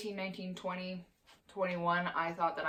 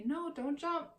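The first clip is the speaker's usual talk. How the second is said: much higher, gentle sing-song correction; telling dog off gently; 7 semitones higher than usual. Shown as a demonstration. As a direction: coax the dog down, kindly. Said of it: "put him back down and say".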